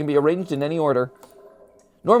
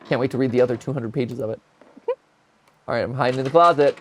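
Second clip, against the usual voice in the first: ordinary talk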